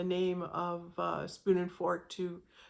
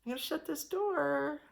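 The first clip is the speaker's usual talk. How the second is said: softly and higher, God closing a door kindly; gentle voice of God; 7 semitones higher, 4 dB quieter than usual. Acted. God gently closing a door on the listener, soft and high. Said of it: "God very gently saying".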